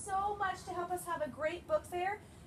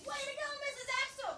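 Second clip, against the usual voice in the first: cheer; 9 semitones higher, faster than usual. Shown as a demonstration. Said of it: "Can you say that too?".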